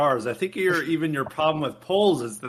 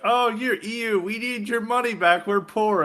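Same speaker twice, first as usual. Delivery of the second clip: lightly voiced, higher-pitched plea; mock Polish pleading; 6 semitones higher than usual. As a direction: whine for money as a cartoon Pole, lightly. Acting out Poles pleading in meme.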